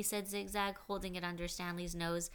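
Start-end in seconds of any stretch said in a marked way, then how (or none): none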